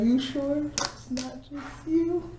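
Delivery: high, slow mock retort. Answering Southerners teasingly, high and slow. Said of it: "We like".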